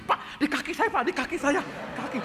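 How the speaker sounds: high-pitched imitation of a panicked man; retold panic. Man alerts the preacher to his legs, acted panic. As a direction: call out in mock alarm about the legs. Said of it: "Jangan panik".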